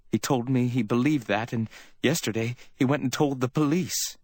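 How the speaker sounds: high, anxious woman's voice; anxious Iva. A narrator's reading. Woman warning Sam about Phil, anxious.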